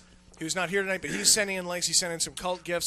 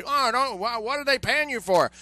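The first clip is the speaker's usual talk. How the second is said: mock complainer; 4 semitones higher than usual. Mocking complaining users.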